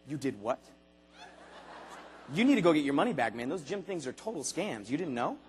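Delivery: low, hushed mock disbelief; scoffing friend. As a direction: sneer it like a know-it-all. Gym skeptic friend dialogue.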